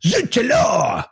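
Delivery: high, hammed-up French cry; French exclamation. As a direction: throw it out like a cartoon Frenchman.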